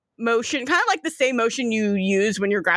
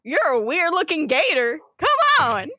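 Southern Floridian gator. Acted. Gator waving in an odd newcomer, Southern Floridian accent. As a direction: call them over in a Southern Floridian accent. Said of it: "the gators are the full Southern Floridian".

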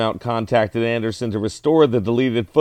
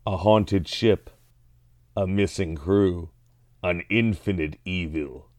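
movie trailer voice; 5 semitones lower, 5 dB quieter than usual. Pretend. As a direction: read it like a horror trailer. Reading tagline as movie trailer.

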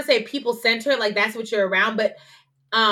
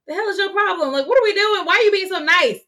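higher, louder exasperated snap; rude retort; 10 semitones higher, 4 dB louder than usual. Retold or imitated. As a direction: throw it back at her in disbelief. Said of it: "I was very much like".

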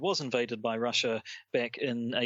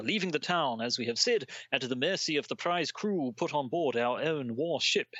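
hammed-up old Victorian accent, pitched higher, reading a news story aloud; 5 semitones higher than usual. Podcast host reading an old hoax news story aloud, in a put-on Victorian accent. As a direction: read it like a Victorian newsman putting on an old-fashioned accent. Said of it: "doing the really quite stereotypical Victorian accent".

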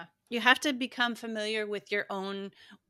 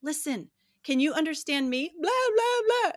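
high, loud mock gibberish on the blahs; mimicking a child's babble; 8 semitones higher, 6 dB louder than usual. Mother mimicking her upset child's garbled talk, high and loud. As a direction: parrot the whining back playfully. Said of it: "I'll say".

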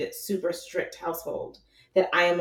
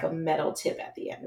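ordinary delivery

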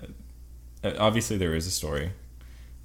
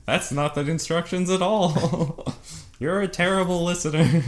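scolding Hayden; 12 semitones higher, 7 dB louder than usual. Retold or imitated. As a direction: scold him in a squeaky voice. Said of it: "He's like".

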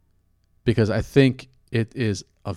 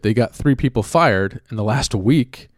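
normal talking voice

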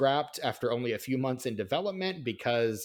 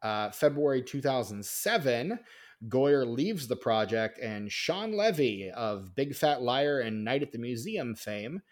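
ordinary delivery

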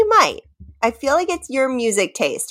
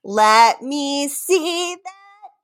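slow hint; 6 semitones higher, much slower than usual. Shown as a demonstration. To her friend, slowly repeating the lyric as a hint. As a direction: draw it out slowly. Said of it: "one of the words you said".

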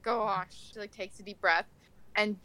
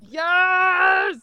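high, loud victory yell; triumphant shout; 9 semitones higher, 10 dB louder than usual. Real, not acted. Player yells in triumph at the dice. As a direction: shout it like you won.

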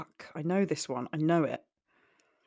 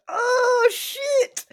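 high, loud burst of realisation; excited exclamation; 11 dB louder than usual. Real, not acted. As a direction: blurt it out, suddenly excited.

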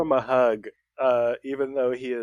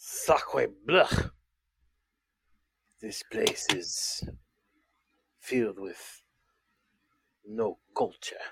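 put-on French accent; 4 semitones lower, 3 dB quieter, slower than usual. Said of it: "He is very French".

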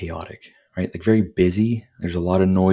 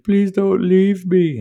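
much higher, louder, slow hammed-up pleading; dramatic plea; 13 semitones higher, 6 dB louder, much slower than usual. Wounded man begging the rescuer not to leave, hammed-up high wail. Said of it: "super dramatic fucking moment".

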